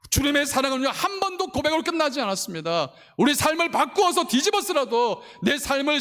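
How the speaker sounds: 높고 힘차게 몰아치는 설교; 절정으로 끌어올려 외치듯 설교해; 열정적 설교. Acted. 강하게 설교함. (크고 높은 목소리로 몰아치는 설교)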